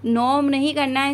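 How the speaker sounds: ordinary talk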